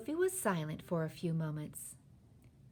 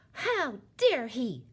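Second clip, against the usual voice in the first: gasp, then slow loud indignation; high, drawn-out affronted exclamation; outraged cry; 7 semitones higher, 8 dB louder, much slower than usual. A narrator's reading. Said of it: "Sophie cried".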